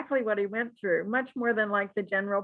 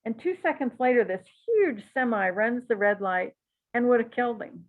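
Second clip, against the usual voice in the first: ordinary talk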